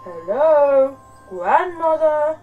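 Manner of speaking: big loud wolf call, high and drawn out; loud, stretched storybook wolf hail; growling wolf. A narrator's reading. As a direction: boom it out slowly as the wolf calling at the door. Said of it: "growled the wolf".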